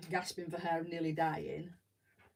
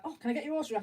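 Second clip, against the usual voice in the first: higher, louder eager plea; fangirling; 8 semitones higher, 5 dB louder, much faster than usual. Retold or imitated. Fan asking the band guitarist for autograph, eager high. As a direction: beg like a starstruck fan. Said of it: "proper fangirling".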